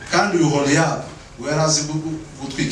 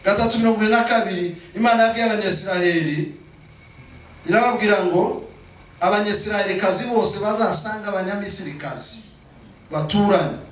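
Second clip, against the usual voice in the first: raised-pitch sermon delivery; insistent preaching; 7 semitones higher than usual. Real, not acted. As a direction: press the point on the congregation. Preaches at full force.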